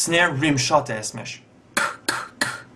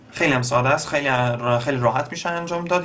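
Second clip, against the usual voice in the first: usual speaking voice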